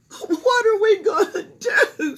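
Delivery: high, loud, slow sobbing plea; mother sobs in despair. A narrator's reading. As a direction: wail it like a worried mother. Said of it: "sobbed Missus Cream".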